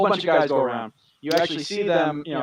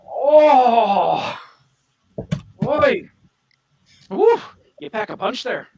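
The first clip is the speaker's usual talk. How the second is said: lightly Irish, higher pitched; a beaten strongman's hint of Irish brogue, pitched up; Irish accent; 9 semitones higher, 4 dB louder, much slower than usual. Beaten arm wrestler praising the winner, in a light high Irish accent. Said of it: "Why did he become Irish all of a sudden?".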